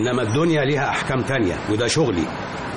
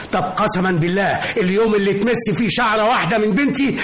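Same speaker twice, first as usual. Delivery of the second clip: furious oath; 9 semitones higher than usual. Acted. Lawyer swearing revenge on the father who threatened his daughter, furious. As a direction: swear it at the top of your voice.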